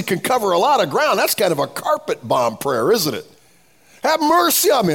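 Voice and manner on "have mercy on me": much higher, louder imitated plea; crying for mercy. Preacher echoing the blind man's plea, imitated cry. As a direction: mimic a beggar's cry for mercy. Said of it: "he's crying for mercy".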